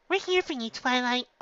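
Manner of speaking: high, bright encouraging call; cheerful call. A narrator's reading. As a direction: cheer up a friend. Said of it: "yelled out with a small smile".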